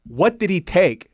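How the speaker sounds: doctor demanding what drug the friends took; annoyed demand. Retold or imitated. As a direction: press them hard.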